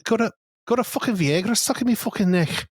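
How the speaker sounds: hammed-up Scouse practice phrase; Scouse accent demo. Shown as a demonstration. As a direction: put on an exaggerated Scouse accent. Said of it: "a Scouse accent, I was like".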